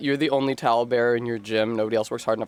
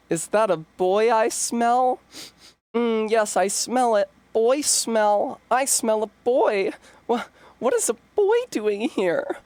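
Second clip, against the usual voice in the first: put-on high, excited girly read of a chat line; dramatic read; 9 semitones higher than usual. Reading a chat message aloud as a boy-crazy girl, high. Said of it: "I should have done an angry read".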